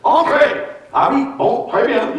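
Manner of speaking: put-on high, pompous French-accented welcome; high officious French inspector voice. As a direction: play a self-important Clouseau-like inspector. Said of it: "a very officious sounding voice says".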